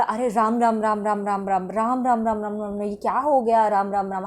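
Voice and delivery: rapid low muttered repetition of dismay; disgusted. Shown as a demonstration. Reacting to someone with disgust, quick low muttering. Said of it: "घृणा सूचक दे रहे हो".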